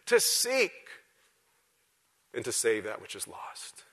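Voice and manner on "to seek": "To seek" lifted high, leaning hard on seek; emphasize "seek" in a raised, ringing pitch; stress on seek. Shown as a demonstration. Preacher showing the church which word to emphasize, stressing "seek". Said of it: "I want you to emphasize that word".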